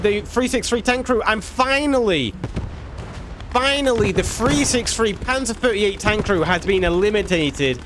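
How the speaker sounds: high-pitched live match commentary; excited play-by-play. Real, not acted. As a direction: call the big moment like a sportscaster. Live play-by-play of tank battle.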